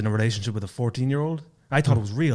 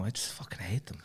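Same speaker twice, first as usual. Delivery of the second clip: whispered; 15 dB quieter than usual. Acted. Bitter joke about Irish tourists.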